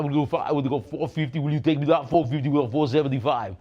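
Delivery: loud mock Austrian strongman; other voice creeping in. Auctioneer pretending another bidder pipes up, loud mock Austrian voice. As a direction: push the bid in a hammed-up German accent. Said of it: "some other voice is creeping up in there".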